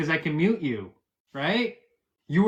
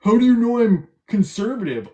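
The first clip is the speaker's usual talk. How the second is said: higher, louder whiny mimicry; mocking imitation; 6 semitones higher, 6 dB louder than usual. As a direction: parrot his question like a brat. Mocking the opponent's words.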